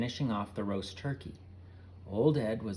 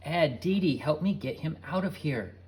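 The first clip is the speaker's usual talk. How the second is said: loud urgent plea; pleading; 5 semitones higher, 4 dB louder than usual. A narrator's reading. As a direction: beg for help. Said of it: "Carrie pleaded".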